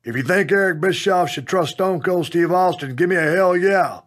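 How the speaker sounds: rally the arena for a response; re-enacted wrestler's call to the crowd; hollering to audience. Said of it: "so I holler to the audience".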